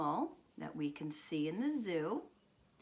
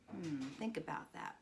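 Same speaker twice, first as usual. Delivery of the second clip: plain reading voice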